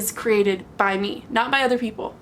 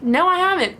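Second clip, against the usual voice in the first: firm denial; 6 semitones higher, 3 dB louder than usual. Retold or imitated. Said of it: "Be like".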